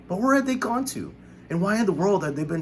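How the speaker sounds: put-on high, wondering young voice; a boy puzzling aloud; lightly voiced James, puzzled; curious boy. A narrator's reading.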